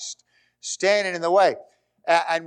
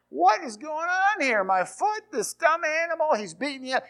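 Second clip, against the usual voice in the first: high, mock-furious rider; hammed-up outrage at a stubborn donkey; angry Balaam; 11 semitones higher, 3 dB quieter than usual. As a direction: play the rider losing it at his donkey. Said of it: "He's angry".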